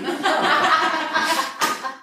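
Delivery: a high burst of real laughter; laughing at the punchline. Real, not acted.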